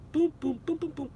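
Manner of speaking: soft, high-pitched little booms; a quick run of high boom noises, fading; imitated booms. Streamer idly filling a pause, soft high booms. Idle sound-making while watching.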